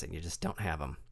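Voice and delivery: ordinary talk, nothing marked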